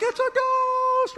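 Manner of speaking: very high, drawn-out frightened cry; mock terror. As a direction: shriek it slowly like you saw a ghost. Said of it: "everybody's like".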